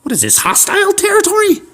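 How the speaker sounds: high falsetto granny, suspicious; hammed-up alarmed old lady. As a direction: put on a very high old woman, challenging. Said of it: "an old lady".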